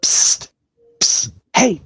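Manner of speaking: hissed psst to get attention. Acted. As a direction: whisper a psst to a friend. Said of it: "this impression where it goes".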